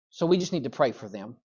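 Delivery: soft, hushed mock-pious aside; pious gossip. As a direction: sneak gossip in as a prayer request. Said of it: "and then we go".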